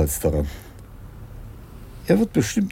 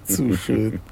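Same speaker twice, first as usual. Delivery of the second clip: laughing; 3 semitones higher than usual. Acted. Chief delights at escape.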